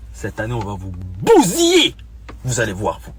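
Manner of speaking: mock threat. Threatening rival teams. (menace moqueuse)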